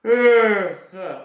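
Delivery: high, loud exaggerated yawn grunt; mock the man's showy yawn; imitated loud yawn. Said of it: "he went".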